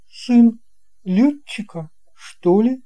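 put-on a boy guessing to himself; puzzled thought. A narrator's reading. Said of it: "подумал Алёшка".

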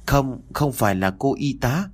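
lightly voiced small boy insisting; sick boy correcting his father; child's voice. A narrator's reading. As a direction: answer like a scared little boy.

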